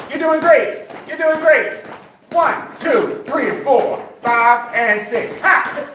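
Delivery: very high, loud, whooping workout cues; aerobics instructor. Acted. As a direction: hype the class like a fitness coach. Leads the cardio with whoops.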